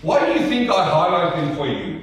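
ordinary talk

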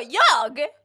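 loud, stunned "me?"; retold disbelief. Friend retelling her stunned reaction, loud disbelief. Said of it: "Hon bara".

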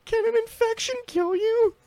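put-on very high childlike whimper; scared little kid. Kid asking dad about infection, very high scared voice. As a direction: voice yourself as a terrified child. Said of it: "I'm like".

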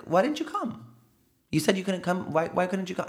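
high, pressing mock innocence; pointed high questioning. Confronting the relative, pointedly high. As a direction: press them to say it to his face. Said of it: "I was like".

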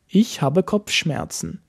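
usual speaking voice

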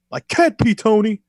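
high, loud imitated outburst; mock frustrated yell. Ortiz yelling at Tony on retold show, mock yell. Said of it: "stares at Tony and yells".

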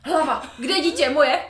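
high, loud startled cry; hammed-up frantic mother; mock frantic panic. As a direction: panic as if the child vanished. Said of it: "úplně jako jsi úplně šílená".

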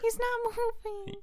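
high, soft whimper; tearful child. Acted. Child showing Dad the still guinea pig, whimpering. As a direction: squeeze it out through sobs. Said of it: "tears aren't gonna make a difference".

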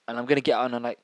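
ordinary talk, nothing marked

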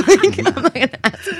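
laughing. Real, not acted. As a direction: say it while laughing. Laughing clarification about proposal.

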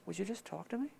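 a quiet, longing ask; soft plea. Retold or imitated. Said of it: "he's just sitting there going".

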